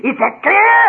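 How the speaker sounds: high, loud demand; high-pitched exasperated cry; exasperated outburst. Acted. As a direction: burst out as your nerves give way. Nervous guest loses his temper.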